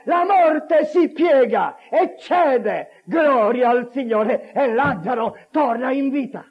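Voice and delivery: high, loud, slow-rolling proclamation; full-force preaching. Real, not acted. Preacher exalting the one who raises the dead, full-force and exultant. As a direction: build to a shouted climax, words drawn out.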